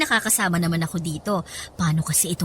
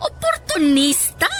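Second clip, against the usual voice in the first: spat out high and slow; brand her an opportunist with contempt; scornful insult; 9 semitones higher, 3 dB louder, slower than usual. Acted. Mother calls Loisa an opportunist, slow and high with open scorn.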